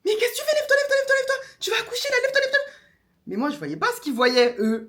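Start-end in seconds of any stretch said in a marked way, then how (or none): 0.0-2.6 much higher, urgent, laughing shouts; alarmed mother. Retold or imitated. Mother sees her pushing on the toilet, frantic high urging. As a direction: yank her up with frantic orders.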